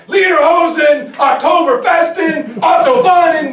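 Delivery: hammed-up German shouting; mock rally shout. Shown as a demonstration. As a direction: bellow like a beer hall rabble-rouser. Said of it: "when to scream and yell".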